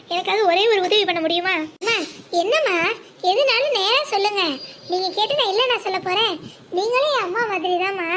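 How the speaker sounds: ordinary talk, nothing marked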